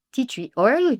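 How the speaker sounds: normal talking voice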